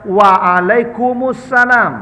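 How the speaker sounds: Waalaikumsalam drawn out slowly, stressing the opening wa; careful slow model reply; slow demo. Shown as a demonstration. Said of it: "Pakai alif lam".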